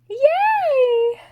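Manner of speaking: high, loud squeal of joy; excited cheer. Real, not acted. Reacting to the book twist.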